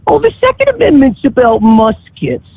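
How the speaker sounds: high, mocking whiny imitation; mocking quote. Caller quoting gun-rights opponents, mocking. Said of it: "some people say".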